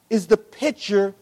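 ordinary talk, nothing marked

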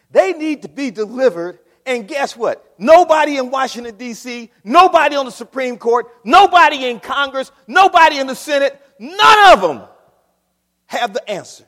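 loud, climbing preacher's roar; rising shout from the pulpit; preaching at full force. Acted. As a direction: build it up and hammer each nobody. Preacher builds to full-force climax.

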